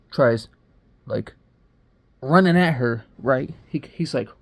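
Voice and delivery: usual speaking voice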